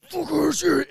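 high, strained mock fury; mock kid rage. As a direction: imitate a little brother losing it, voice squeezed high. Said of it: "he would get so mad".

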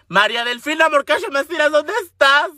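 anuncio agudo de búsqueda; altavoz de patrulla fingido. Pretend. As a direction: vocea el nombre como por altavoz. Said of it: "la madre esta con la que hablan". (llamado policial fingido, en tono alto)

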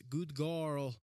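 imitated Russian accent, praising a pet. Talking to dog Stella, playful Russian accent. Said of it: "can't turn on the Russian accent off".

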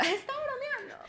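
squeaky high mocking voice, held back; high, slow voice of a dismissive onlooker; mock high excuse.

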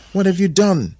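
high, loud dismay; distressed question. A narrator's reading. Adam confronting altered Eve, dismayed high question.

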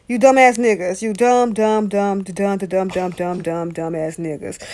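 stuttering dumb, dying away; fading stammer. Real, not acted. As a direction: let the insult stammer and fade to a mutter.

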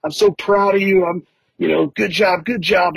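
high, gushing mock voice of the proud husband; imitating the husband. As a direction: gush congratulations in a high put-on voice. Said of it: "And he's like".

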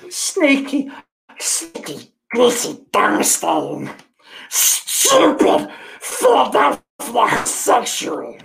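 put-on slow, harsh, shouted stab at an Irish accent; questionable Irish accent. Streamer hurls silly insults at chat, questionable Irish accent. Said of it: "do you think that was my Irish accent?".

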